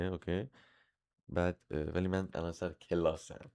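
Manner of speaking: soft, low off-hand murmur; real hushed phone chat, pitched well down; private phone aside. Real, not acted. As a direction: keep the call down during class. Private phone call during live class.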